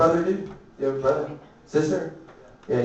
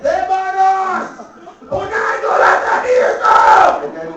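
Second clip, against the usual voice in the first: hammed-up agony. Comic pretending he can't take it, shrieked mock agony. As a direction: scream it out in mock pain. Mock cries of pain, acted.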